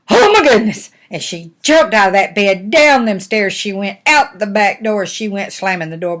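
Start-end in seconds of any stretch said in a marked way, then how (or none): 0.1-0.9 high, loud shriek of alarm; hammed-up fright; startled Goldilocks. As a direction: cry out in sudden shock. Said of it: "and she said".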